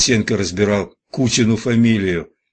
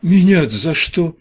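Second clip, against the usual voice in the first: high, raised voice; agitated protest; 9 semitones higher, 6 dB louder than usual. A narrator's reading. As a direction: protest in jittery indignation. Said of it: "задёргался на полу Фин".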